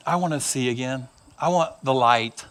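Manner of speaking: low, soft, earnest request; hushed and deep, asking humbly; quiet plea. Acted. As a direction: say it softly, from low down. Blind man's plea, quietly acted.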